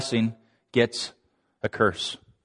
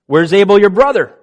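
raised, demanding question; loud, high challenge; questioning Cain; 5 semitones higher, 6 dB louder than usual. Retold or imitated. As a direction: confront him loudly, voice pitched up. God confronts Cain, voiced.

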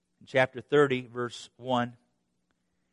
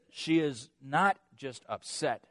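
ordinary delivery

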